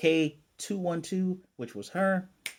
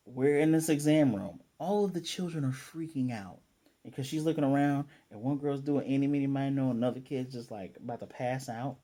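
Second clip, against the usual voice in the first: normal talking voice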